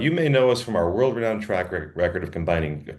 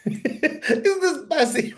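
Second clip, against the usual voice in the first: laughing; much slower than usual. Real, not acted. Laughing repeat of spicy email.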